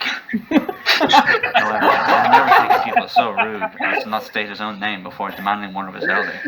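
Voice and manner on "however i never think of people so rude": laughing. Real, not acted. Narrator breaks into laughter.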